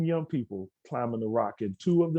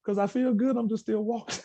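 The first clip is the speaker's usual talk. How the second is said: higher, lightly voiced teenage girl; young girl's voice; 12 semitones higher than usual. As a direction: imitate her, puzzled but happy. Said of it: "She said".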